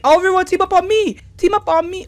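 loud, high-pitched protest; exasperated outburst. Real, not acted. Gamer complaining that enemies gang up, exasperated shouting. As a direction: fire it off high and indignant.

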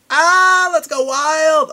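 high, loud whoop; wild cheer. Real, not acted. Vlogger hyping viewers for spring break, loud high cheer. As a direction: cheer it like the party just started.

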